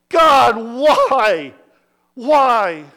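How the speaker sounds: loud pleading outcry to heaven; a raw, loud lament; anguished cry. Retold or imitated. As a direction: throw the question up at God in anguish. Cry to God over barren life.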